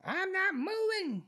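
put-on high, slow, cranky old voice; imitating a stubborn old man. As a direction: dig in like a grumpy old man. Old roommate voiced in story.

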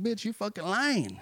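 high, scornful jab; scornful callout. Retold or imitated. Cheated customer calling out the scammer's lie, pitched high and scornful.

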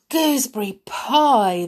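loud, high, delighted echo; excited cry. Acted. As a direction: cry it out with a long falling finish. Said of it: "the dwarves cried".

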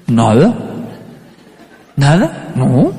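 mock-innocent teen, rising at the end; low, guilty kid feigning innocence; caught-out innocence. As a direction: deny it with a questioning lift. Son caught hiding a snack.

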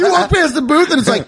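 ordinary talk, nothing marked

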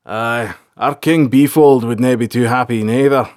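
lightly voiced sergeant, agreeing; wry army sergeant. A narrator's reading. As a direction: speak as a plain-spoken soldier to royalty. Sergeant character in narration.

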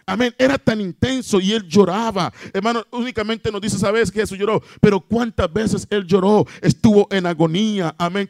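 impassioned sermon delivery; fervent preaching. Acted. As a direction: press the story on the congregation with high-pitched urgency. Preaches fervently on Jesus weeping.

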